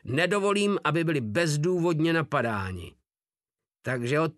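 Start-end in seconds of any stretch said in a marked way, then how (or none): none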